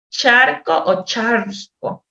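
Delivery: slow, "Charco" said two ways, the r stretched on one take; exaggerated R sound. Shown as a demonstration. Therapist teaches the child to hear the r in "charco", slowly. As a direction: lean on the r in "charco". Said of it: "voy enseñándole a discriminar".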